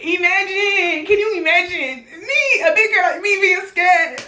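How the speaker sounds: high, laughing disbelief; giggling, high-pitched self-mockery. Real, not acted. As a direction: laugh through it at your own fear. Laughing at herself being scared.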